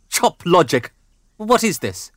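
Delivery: outraged. Acted. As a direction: spit it out in disbelief. Father enraged by reply.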